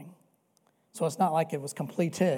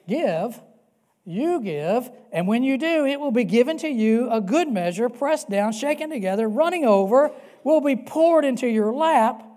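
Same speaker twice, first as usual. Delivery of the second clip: higher, louder, building through the list; fervent preaching; 5 semitones higher, 8 dB louder than usual. Real, not acted. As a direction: pile it up, louder each phrase.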